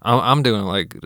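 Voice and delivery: ordinary talk, nothing marked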